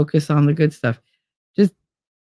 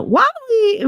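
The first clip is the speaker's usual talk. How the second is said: sing-song greeting. Real, not acted. Greeting chatter by pet name.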